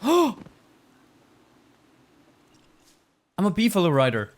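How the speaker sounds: gasping, high excited cry; thrilled. Real, not acted. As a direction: burst out with delight. First beefalo ride.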